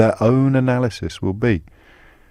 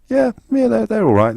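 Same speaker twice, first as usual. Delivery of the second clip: much higher-pitched, mocking voter's voice; mock naive approval; 11 semitones higher than usual. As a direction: imitate a credulous voter in a high voice. Mimics an uncritical voter's thought.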